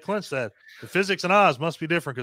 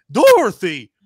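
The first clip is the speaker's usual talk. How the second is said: shouted name; 5 semitones higher, 12 dB louder, slower than usual. Retold or imitated. Scene retold, someone shouting.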